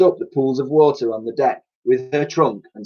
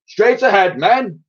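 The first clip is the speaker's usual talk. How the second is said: high, louder admiral's order; commanding; 10 semitones higher, 4 dB louder than usual. A narrator's reading. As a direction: bark it out like a proud old admiral. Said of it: "commanded the Admiral".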